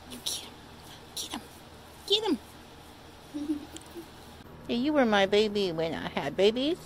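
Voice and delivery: high cooing voice for the cat; doting owner baby-talking her old cat; pet baby talk. Acted.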